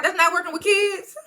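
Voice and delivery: high, incredulous mimicry; mock bewilderment. New York questioning Amber about geriatric work, high mock bewilderment. Retelling New York's confused reaction.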